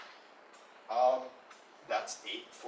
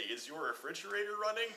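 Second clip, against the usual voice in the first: cheeky quip; 8 semitones higher than usual. Acted. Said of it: "so I could say".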